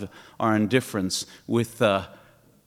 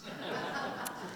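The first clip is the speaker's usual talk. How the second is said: soft laugh; 13 dB quieter than usual. Real, not acted. Laughs at own joke.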